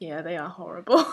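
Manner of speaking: ordinary delivery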